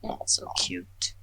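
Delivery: soft breathy whisper; whispered cooing. Real, not acted. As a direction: breathe it out over something cute. Cooing over a kitten.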